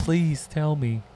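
slow, high-pitched mock plea; mock pleading. Girlfriend waiting for her boyfriend to say it, mock pleading. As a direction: drag it out like a longing girlfriend.

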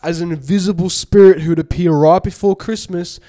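fact reading. Acted. Reads a fact.

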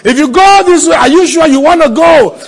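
loud, high-pitched shouted warning; shouted, high, urgent imitation of doubters; warning naysayers. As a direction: cry it out as an alarmed challenge. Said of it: "people will dangle and say".

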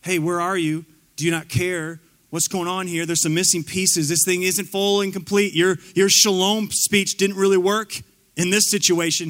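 normal talking voice

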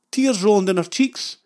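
ordinary delivery

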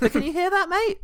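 high, mocking squeak of a complaint. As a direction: imitate an annoyed neighbour, pitched high. Mocking rude cinema neighbour.

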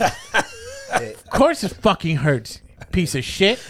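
high, slow, drawn-out scolding; indignant retort. Retold or imitated. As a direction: stretch the insult out in outrage. Retells angry retort to careless barber.

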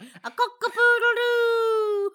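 cock-a-poodle-doo crowed high and slow like a rooster. Shown as a demonstration. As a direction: crow it like a rooster. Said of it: "you did it shit".

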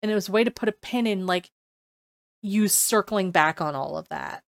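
normal talking voice

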